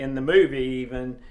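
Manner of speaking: ordinary talk, nothing marked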